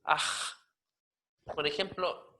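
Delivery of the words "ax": "Aj" as a long voiceless throat scrape; raspy J. Shown as a demonstration. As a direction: rasp the J of "Aj" for double time. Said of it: "una J raspada".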